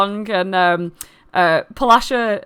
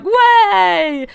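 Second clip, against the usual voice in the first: high, loud crowd whoop; excited cheer; 11 semitones higher, 6 dB louder than usual. Acted. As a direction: cheer like the whole room at once. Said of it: "everyone's like".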